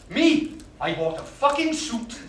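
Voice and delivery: high, loud disbelief at himself; incredulous outburst. Acted. Man marvels at his own suit, incredulous outburst. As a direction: blurt it out, amazed.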